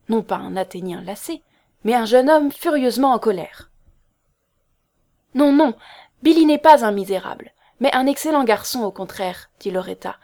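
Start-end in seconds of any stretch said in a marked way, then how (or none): 5.3-9.5 high, loud protest easing off; firm defence. A narrator's reading. Girl defending Billy to an angry man, firm. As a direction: stand up for him firmly. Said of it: "en prenant sa défense avec une fermeté".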